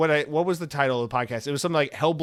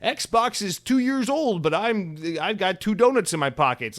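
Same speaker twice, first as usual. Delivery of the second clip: higher, slow goofy delivery of a joke title; silly title voice; 7 semitones higher, 4 dB louder than usual. Retold or imitated. Podcaster mocking his old nonsense titles, goofy higher voice. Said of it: "I'd just be like".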